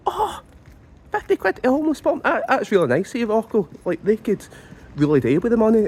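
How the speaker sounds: high, fast, fake-sweet viewer; mocking viewer voice. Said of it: "And you might be saying".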